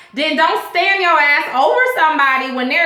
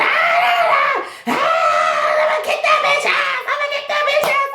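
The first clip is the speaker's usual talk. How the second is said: higher, hammed-up furious yelling, half laughing; mock rage; 10 semitones higher than usual. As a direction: imitate Porsha raging at Cynthia on the boat. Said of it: "Porsha just all laid out".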